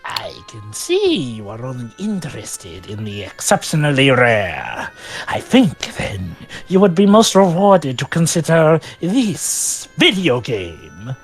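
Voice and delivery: a sly shopkeeper drawing you in; merchant voice. Acted. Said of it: "with the merchant voice".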